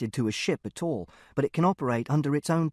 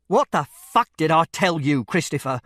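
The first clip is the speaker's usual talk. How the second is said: loud, furious father's outburst; angry raised voice, hard and forceful; much louder anger; 7 dB louder than usual. A narrator's reading. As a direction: explode at your son. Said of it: "This was much louder".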